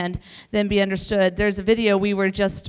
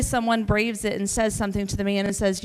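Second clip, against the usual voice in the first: usual speaking voice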